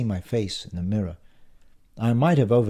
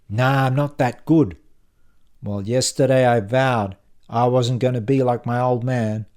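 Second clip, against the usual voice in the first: loud, animated salesman telling his story; louder, fired-up colleague voice; earnest, pushy colleague; 4 semitones higher, 6 dB louder than usual. A narrator's reading. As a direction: talk up your big ambition to a workmate. Acts Brad's speech about his father.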